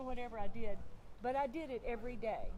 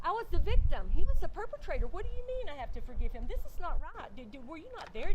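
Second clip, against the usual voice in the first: high, insistent objection; retelling a heated argument, pitch up; indignant protest; 6 semitones higher, 7 dB louder than usual. Retold or imitated. Victim told to forgive her dad, indignant. Arguing back at the Lord.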